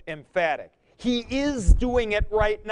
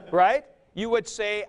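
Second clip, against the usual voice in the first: ordinary talk, nothing marked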